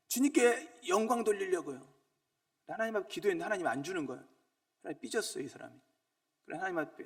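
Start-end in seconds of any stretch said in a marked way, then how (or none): 0.1-1.8 higher, wheedling petition; begging God for a hundred million won; mock pleading prayer. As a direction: play a greedy believer begging God. Greedy man's pious excuse.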